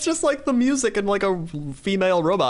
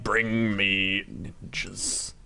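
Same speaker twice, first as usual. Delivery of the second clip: low, quiet, slow commanding lord; Tyrion Lannister; 6 semitones lower, 6 dB quieter, much slower than usual. Retold or imitated. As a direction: drawl it like a scheming noble. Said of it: "He's like Tyrion Lannister".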